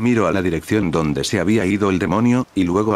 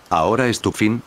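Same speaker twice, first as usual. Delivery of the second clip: ordinary delivery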